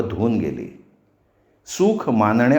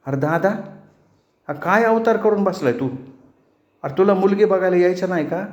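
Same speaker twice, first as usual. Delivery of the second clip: high, hurried and nervous; fearful; 7 semitones higher, faster than usual. A narrator's reading. Sister frightened by her brother's state, nervous high voice. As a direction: ask timidly, a little scared. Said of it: "भीत भीत बोलली".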